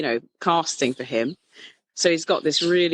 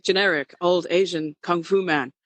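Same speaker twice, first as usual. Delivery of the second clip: usual speaking voice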